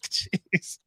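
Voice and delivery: breathy unvoiced jeez; whispered jeez. Telling a friend about the plaque, whispered jeez. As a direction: hush it out in disbelief. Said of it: "I'm like".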